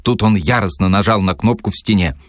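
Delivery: ordinary delivery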